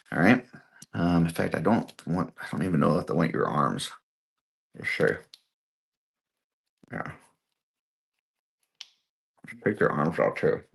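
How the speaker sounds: talking around a paintbrush held in the mouth; mumbling with a brush between the teeth. Real, not acted. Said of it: "that was with the paintbrush in my mouth".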